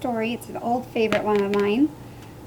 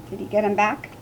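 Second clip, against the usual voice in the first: usual speaking voice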